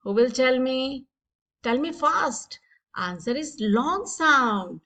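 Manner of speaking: ordinary delivery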